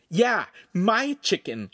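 slow, high, indignant jab; angry. A narrator's reading. Lincoln snaps at the Podesta over his chicken, angry. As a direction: accuse him, stretching the words. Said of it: "Lincoln said angrily".

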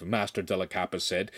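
plain reading voice

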